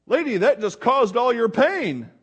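acted bystander's outburst; incredulous protest. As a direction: call her out loudly, in disbelief. Outsider's protest to new mother.

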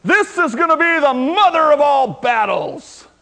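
preacher hamming up a dictator's bragging shout; mock dictator's boast. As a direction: bellow it like a tyrant rallying a crowd. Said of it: "when Saddam Hussein says".

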